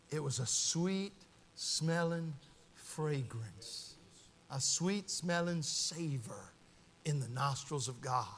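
very soft, low, with pauses between phrases; hushed reverent preaching. Real, not acted. Preacher describing Christ's offering to God, hushed and reverent. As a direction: savor each phrase quietly.

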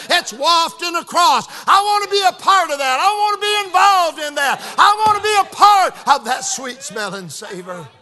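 loud, high preacher's testimony; full-force preaching. Real, not acted. Preacher longing to share in mission work, full-force preaching. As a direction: build to an impassioned peak.

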